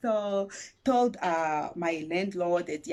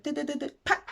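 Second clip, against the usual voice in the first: high rapid "do-do-do" ending in a pop; imitated printer noise; 8 semitones higher, faster than usual. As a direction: mimic a printer chattering, then spitting out. Imitates the award letter printing out.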